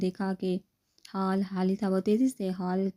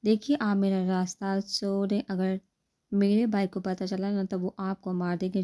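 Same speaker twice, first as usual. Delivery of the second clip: ordinary delivery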